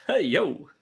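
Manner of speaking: ordinary talk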